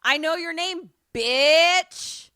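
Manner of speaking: daring a ghost to come get you, shouted; defiant taunt. Pretend. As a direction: yell it right in the demon's face. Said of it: "I'll be like".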